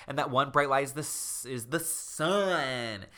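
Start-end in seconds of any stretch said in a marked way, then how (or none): 1.5-3.1 dramatic drawn-out reveal. A narrator's reading. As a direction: stretch SUN out very slowly.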